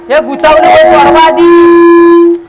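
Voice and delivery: loud, drawn-out cry to untangle; shouting for help. Acted. Organizer yelling that the ribbon is tangled, loud and slow. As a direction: call out that it's stuck.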